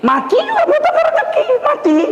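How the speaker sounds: high laughing outburst. Real, not acted. Preacher laughs at his own joke to the congregation, high and laughing. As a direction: laugh through the punchline.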